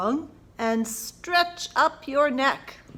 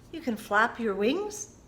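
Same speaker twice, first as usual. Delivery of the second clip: normal talking voice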